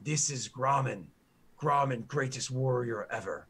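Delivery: slow broken-grammar orc boasting of his hero; proud orc guard. Acted. Orc guard proudly tells the party who Grommen is, slow boasting. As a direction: puff up and drag out each boast like a devoted grunt.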